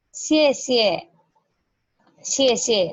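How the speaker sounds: second "xie" light and short; neutral tone demo. Shown as a demonstration. Teacher models neutral tone for class. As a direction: stress the first "xie", drop the second. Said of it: "dibacanya ringan saja".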